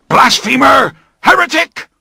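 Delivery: very high, loud fanatic roar; roaring accusation. Acted. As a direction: hurl the words like a mob. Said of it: "the alien voices roared".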